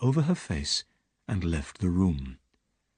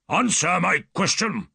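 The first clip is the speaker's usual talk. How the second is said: higher, louder angry demand; angry shout; 10 semitones higher, 4 dB louder than usual. Acted. Roylott demands answers from Holmes, angry shout. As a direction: bark the demand at him. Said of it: "shouted Doctor Roylott angrily".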